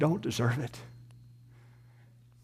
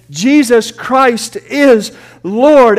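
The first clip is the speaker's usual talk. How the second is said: loud, very slow proclamation; proclaimed; 8 semitones higher, 10 dB louder, much slower than usual. Acted. Preacher crowning the list of names, loud and drawn out. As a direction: stretch every word out, louder.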